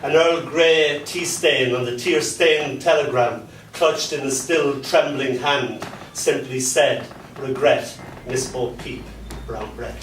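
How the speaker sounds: rhythmic spoken-word delivery; performance poem. Acted. Poet reciting dark fairy tale to a crowd.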